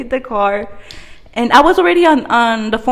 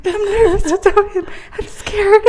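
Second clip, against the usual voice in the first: much higher, laughing fear; mock nervous whine; 11 semitones higher than usual. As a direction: whine it like a scared kid. Jose nervous on the phone.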